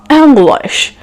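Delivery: "English" drawn out in a fake accent, "Ang-glaish"; slow, showy put-on accent; overdone accent on English; affected accent. Shown as a demonstration. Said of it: "is something else".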